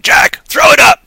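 high, loud shout to Jack; calling out. Real, not acted. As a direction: yell it across the room.

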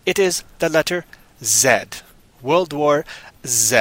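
slow, deliberate Z as "zed"; proper zed. Shown as a demonstration. Teaching the proper film title, Z as deliberate "zed". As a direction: spell it out slowly, Z rhyming with "bed". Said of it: "The proper way to pronounce it".

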